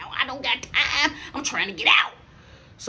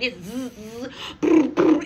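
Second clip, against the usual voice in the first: imitated buzz; 3 semitones higher, slower than usual. Shown as a demonstration. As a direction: buzz like a vibrating phone. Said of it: "My phone is doing a party".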